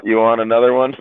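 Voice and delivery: ordinary talk, nothing marked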